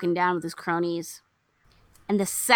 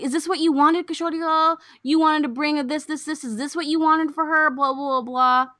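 retold accusation; 7 semitones higher, 3 dB louder than usual. Acts out an angry accusation.

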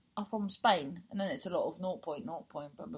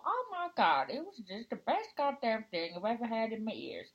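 gushing mock reviewer. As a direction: put on a hammed-up rave review.

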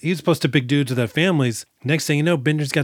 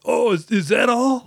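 acted surprise; 9 semitones higher than usual. Acting out reaction to stuck scissors.

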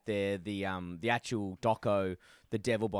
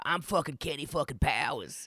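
mock tough-guy swagger, pitched up a little; Kenny Powers impression; 4 semitones higher than usual. Podcaster retelling the actor, voicing Kenny Powers brashly. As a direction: play the foul-mouthed ex-pitcher, lightly. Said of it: "That's how he used to speak".

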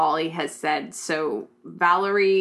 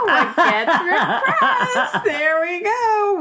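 laughing; 12 semitones higher, 6 dB louder, much slower than usual. Real, not acted. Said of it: "I kinda wanted to punch you".

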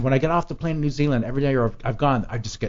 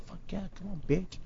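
very soft, raised-pitch private cheer; hushed cockiness; 5 semitones higher, 18 dB quieter than usual. Retold or imitated. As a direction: egg her on under your breath. Private thought as woman approaches.

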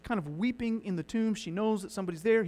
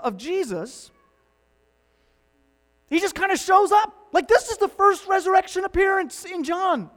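louder, higher preaching that builds to a near shout; fervent preaching; 8 semitones higher, 9 dB louder than usual. Acted. Preacher rousing the congregation, fervent build. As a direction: drive the point home from the pulpit.

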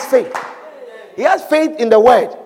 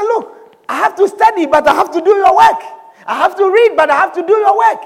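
high, urgent case put to God; insistent pleading; 6 semitones higher than usual. Acted. Student bargains with God over exams: insistent pleading. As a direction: argue your case upward, high and insistent.

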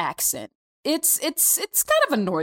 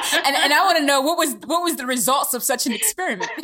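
asking through real laughter, a little high; laughing question; 5 semitones higher than usual. Real, not acted. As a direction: keep chuckling as you ask. Host asks while still laughing.

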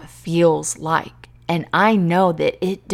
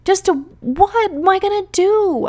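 put-on like a baby; 10 semitones higher than usual. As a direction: whine it out like a devastated little girl. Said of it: "I sound like a baby right now".

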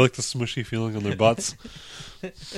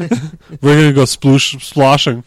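put-on loud Boston accent; brash Boston bro accent; 4 semitones higher, 6 dB louder than usual. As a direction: sell the plan in a Boston accent. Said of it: "the best Boston accent you're gonna get".

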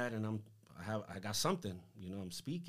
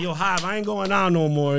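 loud, high, comic panic; freaking out; 7 semitones higher, 16 dB louder than usual. Retold or imitated. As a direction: protest to a friend in mock alarm. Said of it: "I'm freaking".

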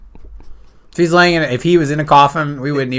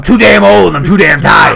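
put-on loud, higher, weary-old-man bellow; mock movie rant; 5 semitones higher, 7 dB louder, slower than usual. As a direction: roar it like a film star. Quotes Pacino film rant.

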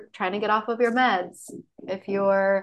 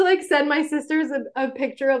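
ordinary delivery

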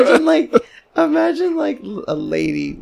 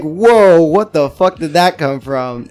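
loud, higher blurt of disbelief; mock astonishment; 4 semitones higher, 6 dB louder, slower than usual. Bystander hearing the sudden accent, mock astonishment. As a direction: act startled, bursting out loud then easing. Acted shock at the new accent.

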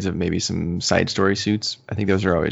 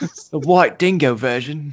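mock Australian accent; 6 semitones higher, 4 dB louder, slower than usual. Host joining the Australian accent bit, hammed-up Australian accent. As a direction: stretch it out like a cartoon Aussie. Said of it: "we have to do it in Australian accents".

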